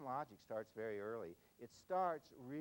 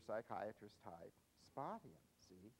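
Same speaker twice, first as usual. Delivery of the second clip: ordinary delivery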